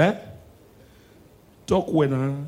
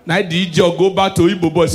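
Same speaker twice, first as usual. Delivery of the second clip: usual speaking voice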